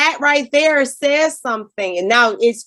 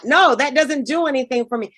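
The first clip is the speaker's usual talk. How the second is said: normal talking voice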